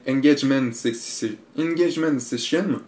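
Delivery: mock English accent on engagement session. Shown as a demonstration. Critiquing a wedding photographer's site, in a mock English accent. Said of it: "avec mon très bel accent anglais".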